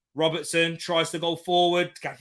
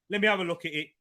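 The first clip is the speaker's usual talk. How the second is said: normal talking voice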